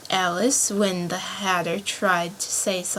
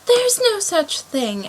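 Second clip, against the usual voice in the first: much higher, slow mild anger; angrily; 11 semitones higher, 3 dB louder, slower than usual. A narrator's reading. Alice protesting to the Dormouse, mildly angry, high and slow. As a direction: start a protest in a huff. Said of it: "Alice was beginning very angrily".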